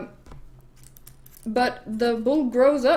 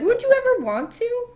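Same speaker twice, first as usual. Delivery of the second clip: very high, hopeful question; sing-song high pleading question; acted coaxing; 13 semitones higher, 3 dB louder than usual. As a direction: coax in a hammed-up high voice. Queen coaxing carpenter into odd favour.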